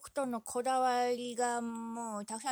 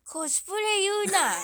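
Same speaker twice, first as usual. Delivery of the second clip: loud scolding; 10 semitones higher, 8 dB louder than usual. Real, not acted. Scolding retort.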